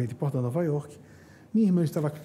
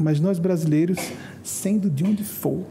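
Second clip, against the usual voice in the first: normal talking voice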